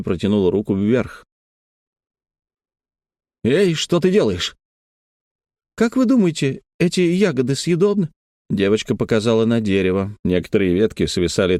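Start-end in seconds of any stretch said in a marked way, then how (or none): 3.4-4.6 higher-pitched startled question; higher, sudden questioning call; startled challenge. A narrator's reading. Calls out as girl reaches up. 5.7-8.1 put-on high-pitched childlike question; much higher child's voice; little girl asking. A narrator's reading. Narrator voices the young girl.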